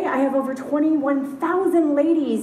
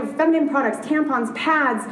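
ordinary talk, nothing marked

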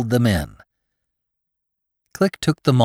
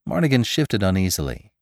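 usual speaking voice

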